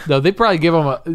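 usual speaking voice

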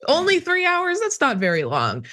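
high-pitched scoffing; mock incredulity. Guest dismissing the rioter's wait, high mock incredulity. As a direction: belittle it with a high, exaggerated lilt. Belittles the three-hour wait.

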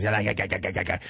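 low, rapid-fire engine rattle; sputtering moped engine imitation; imitated muffler noise. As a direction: chug like a broken Harley muffler. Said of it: "to make that noise".

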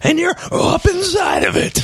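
mock movie yell. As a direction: bellow it like a bad gangster. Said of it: "terrible impression".

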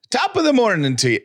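high, lilting mock-Irish greeting; attempted Irish accent. Host urging co-host to celebrate, playful put-on Irish accent. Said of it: "in your best Irish accent".

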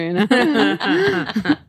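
a real laugh at the end of the story. Real, not acted. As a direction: laugh it off at your own past. Laughing at her own story.

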